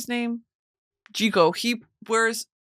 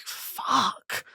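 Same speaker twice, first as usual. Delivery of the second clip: stunned whispered curse; 3 semitones higher, 6 dB quieter than usual. Retold or imitated. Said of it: "I finished it and I was like".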